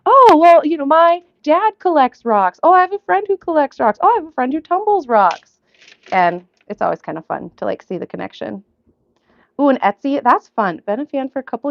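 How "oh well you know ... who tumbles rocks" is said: high, loud mock-eager voices; lifted, emphatic impression of eager small talk; imitating chatty acquaintances. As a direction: play people keen to share a connection. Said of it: "I always hear, like".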